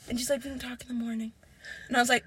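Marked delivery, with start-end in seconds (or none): 0.4-1.3 whispered, low dismissal; hushed, barely voiced put-off; retold brush-off. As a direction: whisper it as an imitated excuse.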